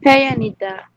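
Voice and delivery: ordinary talk, nothing marked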